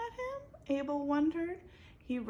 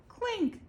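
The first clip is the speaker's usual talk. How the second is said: clink; 13 semitones higher, 4 dB louder than usual. A narrator's reading. Crash sound word.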